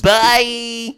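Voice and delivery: long, high, loud sing-out; high-pitched goodbye. Pretend. Co-host signing off the show, high sing-song. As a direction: draw the word out high and playfully. Playful drawn-out sign-off.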